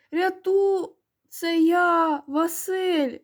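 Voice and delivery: high, stretched-out cry for help; desperate yelling. A narrator's reading. Vasyl in the water identifies himself to boys, desperate cry. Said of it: "зарепетувала голова".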